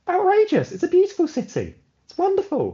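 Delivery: very high, mock-outraged voice; squeakily high theatrical outrage; indignant villain. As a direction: protest loudly in a hammy, high-pitched voice. Villain indignantly defends Milton Keynes.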